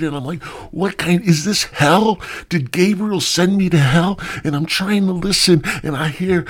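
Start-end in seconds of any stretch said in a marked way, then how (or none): 0.7-4.2 high-pitched, dismayed questioning; distressed disbelief. Acted. Trapped man wonders where Gabriel sent him, distressed disbelief. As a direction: plead for an explanation in a raised voice. Acted dismay at being in hell.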